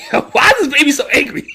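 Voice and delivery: high, laughing disbelief; laughing question. Real, not acted. Cracking up at the angry baby while asking the question. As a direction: ask it through a burst of laughter.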